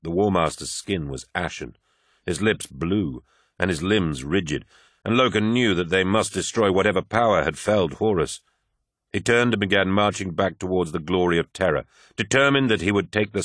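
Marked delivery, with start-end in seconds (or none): none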